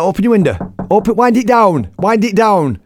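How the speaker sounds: high, loud, insistent orders; urgent shouting. Retold or imitated. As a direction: shout through a closed car window. Shouting through car window.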